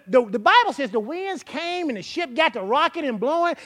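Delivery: ordinary talk, nothing marked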